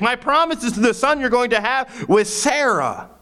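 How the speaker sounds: high-pitched, excited preaching; animated sermon storytelling. Real, not acted.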